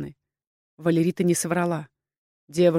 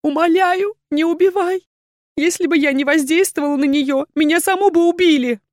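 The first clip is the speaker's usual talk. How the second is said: high, loud, desperate pleading; frightened woman begging for mercy; begging for life; 9 semitones higher, 5 dB louder than usual. A narrator's reading. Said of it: "подняла на герцога умоляющий взгляд".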